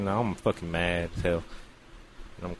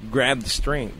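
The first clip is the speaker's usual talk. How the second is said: usual speaking voice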